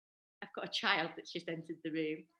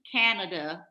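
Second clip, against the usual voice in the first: badly faked Canadian accent, louder; attempted Canadian accent; 5 dB louder, slower than usual. Host checks if she has it right, attempted Canadian accent. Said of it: "Is that right?".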